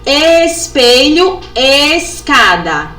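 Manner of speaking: "Espelho, escada" very slow, both "es" stretched; matching first sounds. Shown as a demonstration. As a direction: hold the shared "es" in "Espelho, escada". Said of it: "Começa com o mesmo som?".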